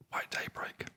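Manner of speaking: plain reading voice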